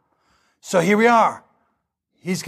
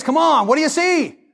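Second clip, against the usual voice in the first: irritated professor; 7 semitones higher than usual. Retold or imitated. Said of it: "He's getting irritated now".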